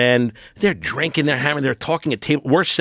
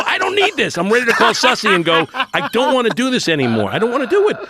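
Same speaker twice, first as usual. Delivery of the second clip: much higher, louder frantic pleading; mock panicked whine; 12 semitones higher, 3 dB louder than usual. Ready to call Sussy and quit, higher and louder in mock panic. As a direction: squeal it like you're about to quit. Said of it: "freaking out".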